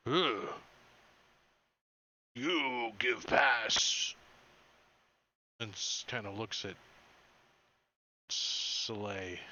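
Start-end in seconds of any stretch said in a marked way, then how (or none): 0.1-4.1 slow, loud, dim grunt; grunting brute. Acted. Guard demands a pass from the stranger, slow grunting brute. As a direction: drag out every word like a dull-witted guard. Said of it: "the guy in the back turns and says".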